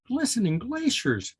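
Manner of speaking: high squeaky squirrel voice; squeaked. A narrator's reading. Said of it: "squeaked Sally Squirrel".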